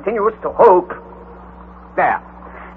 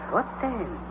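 much softer, trailing off; softer echo; 14 dB quieter than usual. Acted. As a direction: let it die away. Echo fading.

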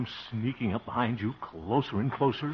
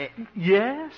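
high, loud eager answer; squeaky, expectant reply; startled hope; 14 semitones higher, 5 dB louder than usual. Acted. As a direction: jump on it with sudden hope. Startled, hopeful at being called back.

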